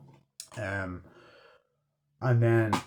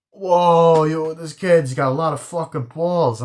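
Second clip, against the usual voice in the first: excited; 5 semitones higher, 6 dB louder, slower than usual. Acted. Impressed gangster calling out.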